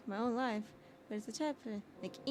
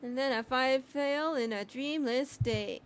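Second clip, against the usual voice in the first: slow, drawn-out storybook narration; dreamy narration; 4 semitones higher, 5 dB louder than usual. Acted. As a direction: read it like slipping into sleep. Reading game narration dramatically.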